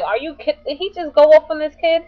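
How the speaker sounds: worked-up, high, incredulous retelling; retold outraged disbelief. Retells own outraged reaction to guy.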